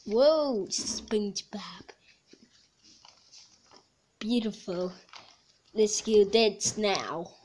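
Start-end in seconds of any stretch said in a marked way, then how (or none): 0.1-4.9 whisper. Real, not acted. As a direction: breathe the name in awe. Whispering in awe at game.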